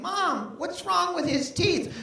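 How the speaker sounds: put-on high, stretched-out little kid voice. Little kid behind him asks Mom about teeth, high and slow. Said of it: "hearing a little kid behind me saying".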